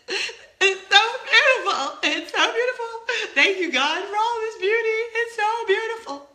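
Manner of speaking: high, loud, laughing-crying awe; overcome with awe. Retold or imitated. Vlogger reliving the ride, overwhelmed and laughing. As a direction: gush through tears of joy. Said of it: "getting like".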